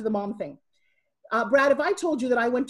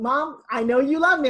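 higher, louder imitation of a child; daughter's voice; 4 semitones higher, 6 dB louder than usual. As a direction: lightly act out a daughter assuring mom. Daughter's voice in retold calls.

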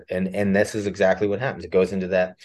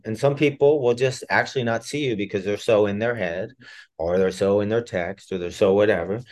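ordinary delivery

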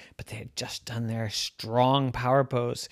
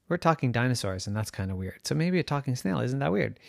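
usual speaking voice

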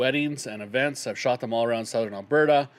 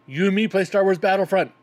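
put-on eager kid; 6 semitones higher, 5 dB louder than usual. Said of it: "the first thing he says to me is".

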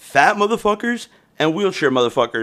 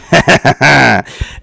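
laugh; 2 semitones higher, 7 dB louder than usual. Real, not acted. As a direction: burst of rapid chuckles. Laughs at own handicap joke.

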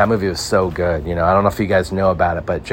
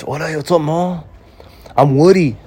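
Tom Hanks as street thug; 9 semitones higher than usual. Retold or imitated. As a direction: squeak out a silly gangster intro. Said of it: "You think Tom Hanks is gonna take a role on as a... street thug".

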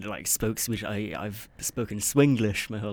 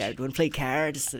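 higher, imitated Nova Scotian with fronted "cards"; Nova Scotia accent; 4 semitones higher than usual. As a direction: mimic the Nova Scotia dude, amused. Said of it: "He was from Nova Scotia".

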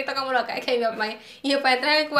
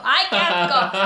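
loud, lower exclamation of disgust; mock disgust; 4 semitones lower, 6 dB louder, slower than usual. Imagining pee falling from the bunk, mock disgust. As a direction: exclaim loudly in playful revulsion.

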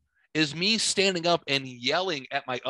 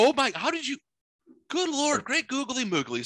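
high-pitched exaggerated exasperation; scandalized, pitch jumping way up; mock disbelief; 11 semitones higher than usual. Host to co-host caught unprepared, mock disbelief.

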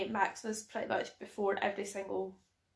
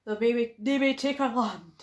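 louder, slow movie-hero delivery; mock Braveheart rallying cry; 6 dB louder, slower than usual. Friend declaiming Braveheart to her co-host, slow and loud. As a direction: declaim it like a Scottish rebel leader.

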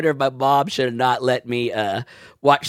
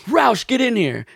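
mock angry summons, pitched up; mad coach, imitated; 7 semitones higher than usual. Mad coach calling a student in, imitated angry order. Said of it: "he got so mad at me".

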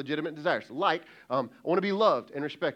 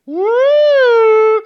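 sudden high whoop, much louder; high loud woo; 21 dB louder than usual. Acted. Preacher exclaims about blow up.

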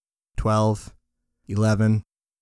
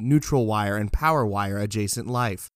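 plain reading voice

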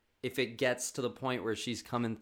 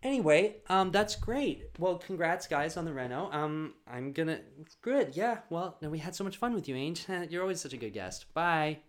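bright fake politeness, pushing the guest toward the door; rushed goodbye; 5 semitones higher than usual. Hosts hurrying the nosy neighbor out, high forced cheer.

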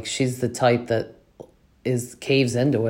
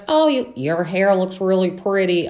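higher-pitched mock honky-tonk twang; attempted honky-tonk accent; 6 semitones higher than usual. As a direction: imitate her honky-tonk accent, not quite getting it. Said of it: "I can't do her accent".

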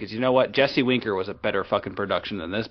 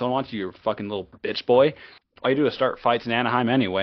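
usual speaking voice

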